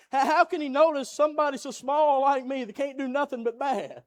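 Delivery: rising, pleading preacher's cry; fiery preaching. Acted. Preaching builds to full force.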